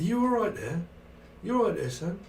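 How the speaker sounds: high-pitched London needling; London gangster film voice. Acted. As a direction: challenge someone like a film hard man. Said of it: "It's a bit like Lock, Stock".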